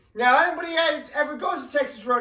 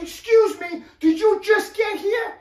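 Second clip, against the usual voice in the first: higher, indignant stranger's voice; pushy woman; 8 semitones higher than usual. Retold or imitated. Woman in line challenges the narrator, high indignant voice. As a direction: play the queue-jumping accuser, high and sharp.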